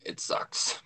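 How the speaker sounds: ordinary talk, nothing marked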